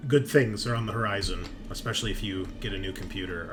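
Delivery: ordinary talk, nothing marked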